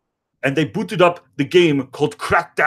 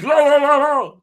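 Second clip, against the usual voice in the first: silly singsong; 7 semitones higher, 4 dB louder than usual. Retold or imitated. Button mashing chant.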